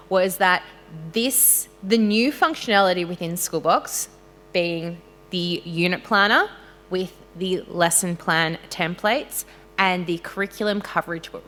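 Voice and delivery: ordinary talk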